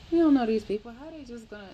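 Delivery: high, loud alarmed outburst; wife panicking over a surprise gift; freaking out. Retold or imitated. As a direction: blurt it out in a panic. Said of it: "Rhea is freaking out".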